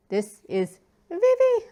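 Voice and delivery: high, loud burst on the name; excited insistence. Pretend. Fan brushing off other pronunciations, excited insistence. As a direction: insist gleefully, voice leaping up on Vivi.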